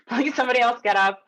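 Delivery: laughing through a mock plea. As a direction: giggle it out as a silent prayer. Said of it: "And I'm thinking".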